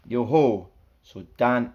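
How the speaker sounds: usual speaking voice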